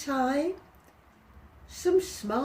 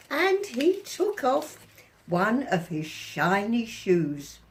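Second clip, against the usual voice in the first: ordinary delivery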